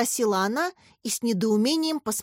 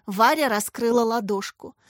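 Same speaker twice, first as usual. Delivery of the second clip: plain reading voice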